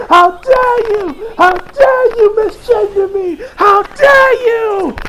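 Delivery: high, loud, drawn-out indignant shriek; hammed-up scandalized scream; over-the-top falsetto fury, slow and shouted; mock outrage. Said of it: "'cause he's like".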